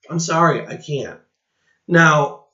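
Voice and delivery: ordinary delivery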